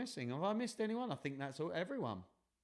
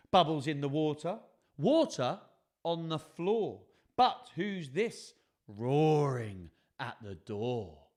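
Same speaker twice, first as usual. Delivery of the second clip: lively picture-book narration; 3 semitones higher, 6 dB louder, slower than usual. A narrator's reading. Reading a bath-time story to children, bouncy and suspenseful. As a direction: read it rhythmically, building to the question.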